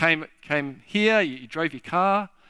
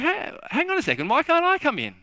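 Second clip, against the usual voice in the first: much higher, fast, outraged hang on a second; refused at the church door, high indignant protest; 13 semitones higher, faster than usual. Acted. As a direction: shoot the voice up in disbelief. Visitor refused entry protests.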